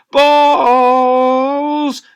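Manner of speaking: a huge loud high bellow, drawn out; teacher charging about, bellowing balls. Acted. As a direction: bellow it across the playground.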